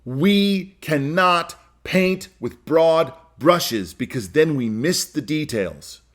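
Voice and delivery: each word laid down slowly; measured emphatic statement; slow and deliberate. Shown as a demonstration. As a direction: spell it out word by word for weight. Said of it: "Here's the first thing I believe".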